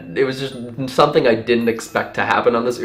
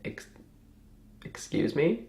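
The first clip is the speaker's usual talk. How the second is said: small, high, faltering voice; quiet acted disbelief; stunned; 5 semitones higher, 13 dB quieter, slower than usual. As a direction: barely get it out, taken aback. Stunned reply to her question.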